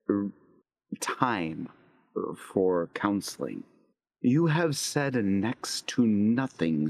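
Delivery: a grave, deliberate counselor ending the session; stern chaplain. Acted. Chaplain voiced in scene.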